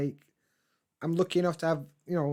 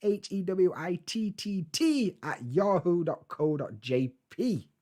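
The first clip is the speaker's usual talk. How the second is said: spelled out slowly; 4 semitones higher, slower than usual. Shown as a demonstration. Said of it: "So three T's".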